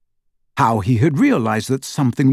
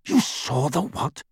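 sharp; 2 semitones higher, 6 dB quieter than usual. A narrator's reading. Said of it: "said Hermione sharply".

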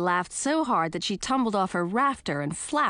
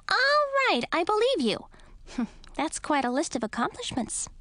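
laughing bat voice; 11 semitones higher than usual. A narrator's reading.

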